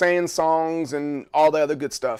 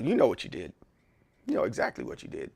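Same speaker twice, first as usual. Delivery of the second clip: a low, softer, knowing accusation; low mock accusation; 5 semitones lower, 7 dB quieter, faster than usual. Shown as a demonstration. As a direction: answer low and cool, like he knows. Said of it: "just, just like that".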